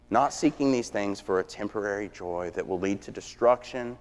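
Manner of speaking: normal talking voice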